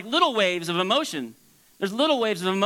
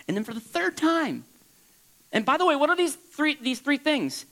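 normal talking voice

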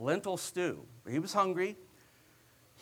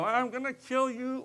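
light, tossed-off threat, pitched higher; offhand threat; 7 semitones higher than usual. Retold or imitated. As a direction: throw it away like an empty threat. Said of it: "he wasn't just saying it like".

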